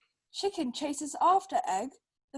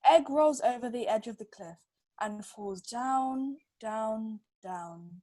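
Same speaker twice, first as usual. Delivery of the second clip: each down softer and lower; fading down; 3 semitones lower, 4 dB quieter than usual. Acted. Falling, fading.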